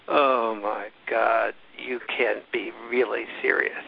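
slow, drawn-out mock disbelief; eye-rolling disdain. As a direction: imitate an exasperated interviewer, dragging "Oh my God". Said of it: "she'd roll her eyes and go".

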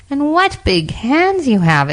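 high, drawn-out amazement; astonished exclamation. A narrator's reading. Girl steps toward the bed, exclaiming high. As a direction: exclaim slowly, pitched well up.

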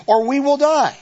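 sudden high alarmed cry. Acted. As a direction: blurt out the danger. Eve's fearful line.

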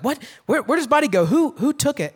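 high, startled questions, voice up; confused alarm. Retold or imitated. Disciples alarmed at the empty tomb, high startled questions. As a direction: act out disciples panicking over the missing body. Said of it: "they're like".

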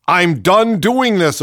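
slow, loud, fed-up delivery; annoyed. Retold or imitated. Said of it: "clearly annoyed".

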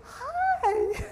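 very high, fake-friendly hello; forced greeting. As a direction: squeak out a put-on polite greeting. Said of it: "I turned around and said".

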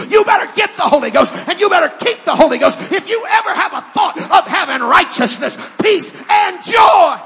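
rising from firm to shouted preaching; building to full-force preaching. Acted. Preacher to congregation, climbing into a shout. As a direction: build it up till you are shouting the gospel.

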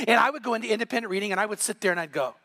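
ordinary talk

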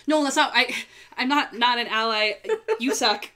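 high, loud mock outburst; retold yelling. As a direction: re-enact an indignant, flustered yell. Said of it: "the awkward position of yelling".

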